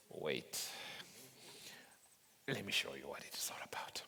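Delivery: fast secretive whisper. Acted.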